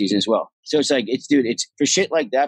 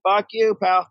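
mock retort; 9 semitones higher, slower than usual. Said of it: "he's like".

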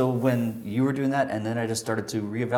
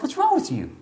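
much higher and louder, pressing; insistent high question; 6 dB louder than usual. Retold or imitated. Pax pressing Mark about his mood, high insistent question. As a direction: demand it, half alarmed and half caring.

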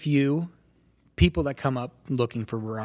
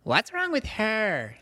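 put-on high, slow small child asking; a small kid peeking in, curious; little child voice; 9 semitones higher, much slower than usual. Little brother asking his mother about his sister, small high voice. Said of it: "you hear a little voice".

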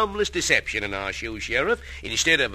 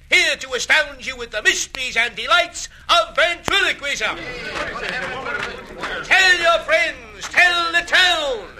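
showman's barker call; 9 semitones higher than usual. Acted. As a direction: drum up the crowd like a showman. Barker addressing the crowd.

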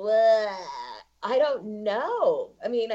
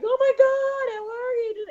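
much higher, squealing celebrity hello; gushing fake greeting; 3 dB louder than usual. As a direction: gush like seeing an old friend. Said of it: "you're like".

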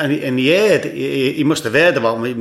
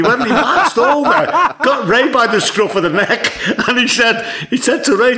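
laughing through the words, pitched up high; can barely get it out for laughing; giggling while retelling the punchline; 11 semitones higher than usual. Real, not acted. Laughing while telling the story.

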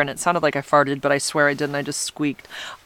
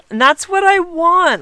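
excited; 14 semitones higher, 5 dB louder than usual. Real, not acted. Thrilled by listener's kind comment.

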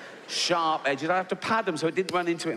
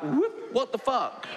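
startled; 8 semitones higher, slower than usual. Retold or imitated. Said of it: "She'd come back".

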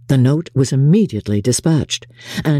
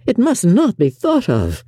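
emphatic, higher-pitched objection; shocked protest; 7 semitones higher than usual. A narrator's reading. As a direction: object firmly, pitch raised in dismay. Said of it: "cried Bingley".

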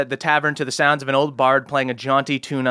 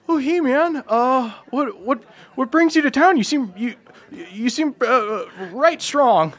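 put-on higher, eager nervous young voice; nervous halfling boy; 11 semitones higher than usual. Halfling boy greeting a big stranger, high and nervous. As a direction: squeak it out like a jittery kid. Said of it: "he turns to you and he says".

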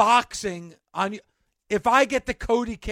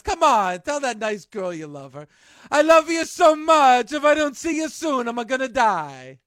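Clemenza's Italian voice; 4 semitones higher, 6 dB louder than usual. Retold or imitated. Clemenza coaxing Michael on the phone, in a loud mock Italian accent. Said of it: "And then Clemenza says".

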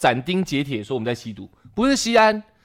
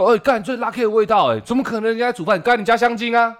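mock accusation; 9 semitones higher than usual. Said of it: "我是不是也可以这样讲".

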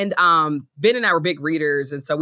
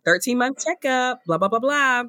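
high, sing-song update voice; mock upbeat announcement; 7 semitones higher than usual. Mother imitating her old milestone posts, high and mock upbeat.

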